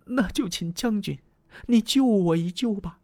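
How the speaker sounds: suddenly hopeful, begging for rescue; sorrow turned to joy. Acted. Diao Chan turns hopeful, begging the general to save her. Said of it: "转悲为喜了".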